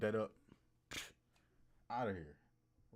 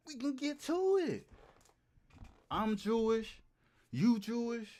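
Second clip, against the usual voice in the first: excited; 7 semitones higher, 4 dB louder, slower than usual. Acted. Pitching Seth Rogen a collab.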